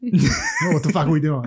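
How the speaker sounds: thick Boston bro voice; Boston accent. Retold or imitated. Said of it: "Oh, it's back".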